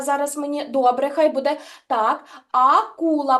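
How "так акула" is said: slow акула with a wide-open mouth; slow demo word. Shown as a demonstration. Teacher showing kids the A sound, slowly. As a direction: draw out акула slowly for the children. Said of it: "подивись на мій ротик".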